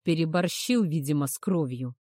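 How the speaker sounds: usual speaking voice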